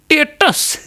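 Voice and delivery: high, excited gossip voice calling her friend; gossiping village woman. Retold or imitated. Comedian mimics village women calling each other to see a status, high excited voice.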